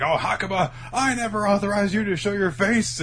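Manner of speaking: high, loud mock rebuke; indignant outburst. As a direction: act out an outraged scolding. Acting character rebuking his other personality.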